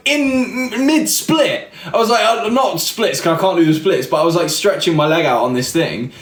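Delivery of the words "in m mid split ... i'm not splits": high-pitched, laughing through the words. Real, not acted. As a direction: tell it while cracking up. Cracking up telling awkward encounter.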